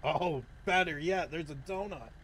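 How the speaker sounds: ordinary talk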